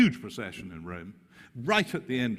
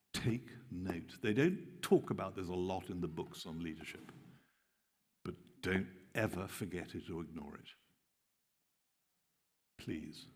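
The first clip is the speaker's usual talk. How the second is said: earnest hush; 3 semitones lower, 7 dB quieter than usual. Real, not acted. Earnest plea to leaders.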